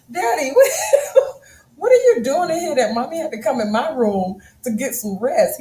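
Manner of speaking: high and loud, laughing through it; laughing child quote. Retold or imitated. Quoting son to husband about snoring, laughing. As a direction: crack up voicing the son. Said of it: "Even my son said".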